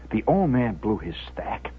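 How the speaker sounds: low, soft storytelling voice; hushed narration. A narrator's reading. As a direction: state it quietly and gravely. Same event told in low voice.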